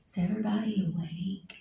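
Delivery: soft, breathy whisper. Shown as a demonstration. Checking whether everybody is awake, whispering. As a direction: whisper it into the mic. Repeats the test much softer.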